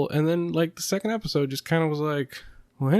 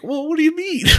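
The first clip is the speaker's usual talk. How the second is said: very high, squeaky incredulous reaction; exaggerated comic confusion; mock bafflement; 13 semitones higher than usual. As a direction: act out baffled disbelief, pitch shooting way up. Acts out baffled reaction to character.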